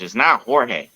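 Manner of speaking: "Jorge" said hor-hey, Spanish h sound; Jorge with English J. Shown as a demonstration. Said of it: "Jorge is J O R J".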